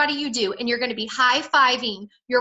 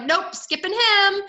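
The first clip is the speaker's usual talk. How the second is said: slow, higher, mock dismissive refusal; dismissive brush-off; 7 semitones higher, slower than usual. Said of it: "and you're like".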